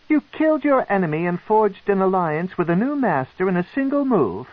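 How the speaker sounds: high, pleased drow queen; amused matron. Acted. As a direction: savor the clever move as a matron.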